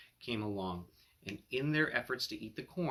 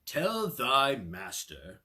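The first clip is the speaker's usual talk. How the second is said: slow, louder regal king voice; the king speaking; 7 dB louder, much slower than usual. Acted. King thanking the cat for his gift, slow and grand. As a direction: hold each word like a proud king.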